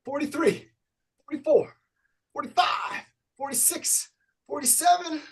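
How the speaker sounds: high, energetic call of the count; upbeat workout instructor counting reps out loud; fitness coach counting. Acted. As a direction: pump the class up as you count each rep. Leads a jumping jack count.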